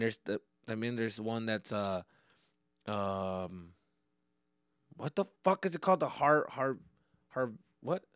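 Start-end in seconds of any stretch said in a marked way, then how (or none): none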